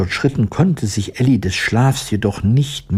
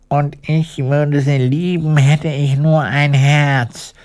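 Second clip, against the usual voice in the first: higher, longing sigh of a heartless man; sighing tin woodman; 6 semitones higher than usual. Acted. Tin woodman lamenting to friends, higher wistful sigh. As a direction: wish for a heart, wistful and high. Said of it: "seufzte der eiserne Holzfäller".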